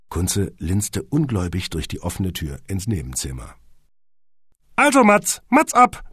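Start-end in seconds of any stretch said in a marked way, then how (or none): 4.7-6.0 high, loud director's call; cheerful shout. A narrator's reading. Horsti starting the film take, shouting cheerfully. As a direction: sing out the command in high spirits. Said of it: "rief Horsti gut gelaunt".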